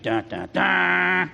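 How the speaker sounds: menacing movie sting, last note drawn out; villain music imitation. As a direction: sound out a villain's theme, slow and forceful. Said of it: "bad guy has bad music".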